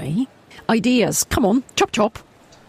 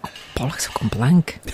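lower, hushed whisper; whispered panic; 6 semitones lower than usual. Real, not acted. Admitting writer's block to her co-writer, hushed whisper. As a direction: curse under your breath.

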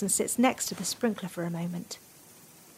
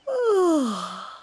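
sleepy sigh; 7 semitones higher, 4 dB louder than usual. Acted. Bees calm down, sleepy sigh.

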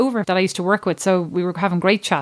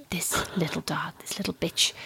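low, quiet, venomous whisper; seething whisper; 4 semitones lower, 10 dB quieter than usual. Retold or imitated. Mum cursing the little dog, seething low whisper. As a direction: imitate her cursing under her breath. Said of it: "As Kerry said".

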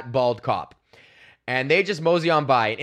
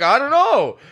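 much higher, louder feigned confusion; mock playing dumb; 11 semitones higher, 8 dB louder than usual. Comic telling cop he has no idea, playing dumb. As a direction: act clueless at the cop. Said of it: "I'm just literally like".

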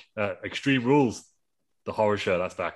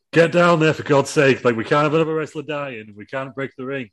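raised, high-pitched urgent telling-off; exasperated order; 6 semitones higher, 5 dB louder than usual. Acted. Regal ordering Cheeks off the top rope, exasperated shout. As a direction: call out sharply, loud at first.

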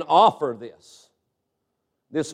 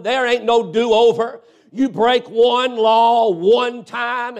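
raised, driving preacher voice; preaching; 3 semitones higher than usual. Acted. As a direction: preach it hard to the congregation. Preacher at full force.

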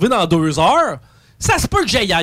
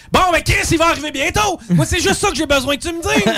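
exasperated; 8 semitones higher than usual. Retold or imitated. Retelling his rant at manager.

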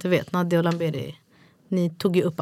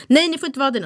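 higher-pitched mild telling-off; imitated parental no, raised at the start; scolding; 6 semitones higher, 3 dB louder than usual. Mum showing how she starts setting limits, scolding.

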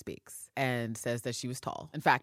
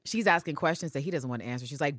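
ordinary delivery

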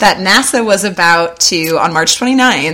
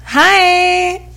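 cutesy greeting; 12 semitones higher than usual. Acted. Greeting a new fan.

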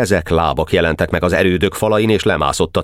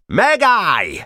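high, drawn-out yell to halt; yelled command; 13 semitones higher than usual. A narrator's reading. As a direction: yell after a fleeing enemy in a high pitch. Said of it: "ordította feléje Ács Feri". (magas hangú, parancsoló ordítás)

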